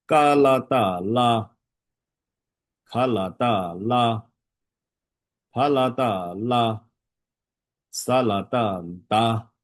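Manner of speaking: slow, drawn-out syllables for learners to follow; stretched-out teacher's spelling drill; slow practice drill. Shown as a demonstration. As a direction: chant each set slowly and evenly. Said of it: "练习一下".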